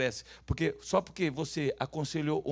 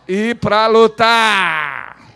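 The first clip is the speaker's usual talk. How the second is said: long drawn-out shout, pitched up; loud rallying call; 5 semitones higher, 18 dB louder, much slower than usual. Shown as a demonstration. Preacher gives the congregation words to repeat, loud rallying call. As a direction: stretch it out as a battle cry. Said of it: "Fala assim, ó".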